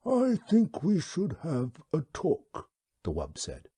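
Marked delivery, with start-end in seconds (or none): 0.1-2.7 slow, high, unhurried alien creature voice; wub's voice. Acted. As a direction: speak as a placid, courteous old beast. The wub speaks.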